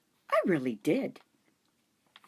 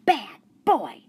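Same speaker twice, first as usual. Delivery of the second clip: loud, high "Bad boy!"; girl telling off the dog, loud and firm; firm scolding; 7 semitones higher, 7 dB louder than usual. A narrator's reading.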